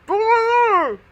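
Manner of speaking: high, loud, garbled mask voice; imitating a masked man's muffled holler; muffled through a mask. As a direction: shout an unintelligible word into a mask. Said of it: "So this is his mask".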